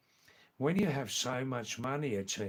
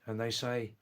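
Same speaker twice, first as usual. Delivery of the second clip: ordinary talk, nothing marked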